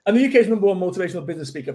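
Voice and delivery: fast, practised boast; rattled off fast. Shown as a demonstration. Speaker retrying his tongue-twister title at speed. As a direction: rattle the whole title off quickly. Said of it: "Easy for me to say".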